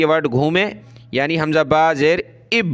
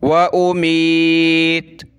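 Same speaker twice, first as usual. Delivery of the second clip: Quran recitation; much slower than usual. Shown as a demonstration. Teacher recites the verse slowly for pupils. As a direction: intone it slowly like tilawat.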